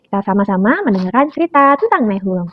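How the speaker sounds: ordinary delivery, nothing marked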